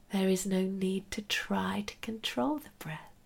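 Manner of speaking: soft whispered meditation guidance; right in your ear. Acted.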